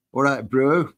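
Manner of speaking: a self-mocking attempt at a New Zealand accent, lightly done; a quick, half-hearted Kiwi voice for the viewer; bad Kiwi accent. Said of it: "that's the worst Kiwi accent ever".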